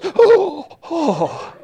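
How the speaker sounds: high shocked mother's gasp "Oh, oh."; mock shocked mother. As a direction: play the mother caught out, squeaking high.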